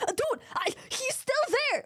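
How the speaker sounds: high squeaky horse, disbelieving; mock horse voice. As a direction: squeak it out like a horse that can't believe it. Horse talking in the story.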